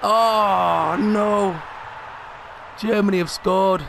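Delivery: real dismay as the goal goes in; despairing shout at the conceded goal; dismayed cry. Real, not acted. Goal conceded in FIFA match.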